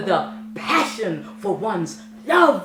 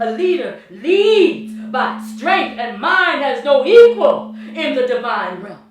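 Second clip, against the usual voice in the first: booming declaration; 3 semitones higher, 9 dB louder than usual. Acted. Set proclaiming his own strength to the court, booming. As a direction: thunder it out to the court. Repeated line, second take loud.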